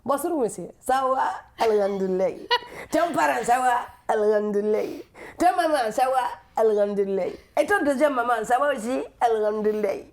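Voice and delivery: put-on beaming Malian accent, generous singsong greetings; smiling Malian accent. Comedian imitates Malians greeting each relative, smiling Malian accent. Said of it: "ils sont souvent très souriants".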